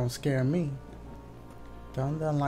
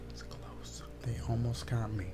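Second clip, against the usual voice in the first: tense hushed whisper near the monster; 6 dB quieter than usual. Real, not acted. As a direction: breathe it out, barely voiced. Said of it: "I'm tired of talking so low".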